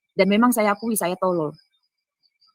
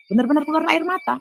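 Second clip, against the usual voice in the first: incredulous; 9 semitones higher, 4 dB louder than usual. Real, not acted. Marvelling that he really cried.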